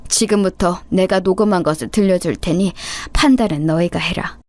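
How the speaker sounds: plain reading voice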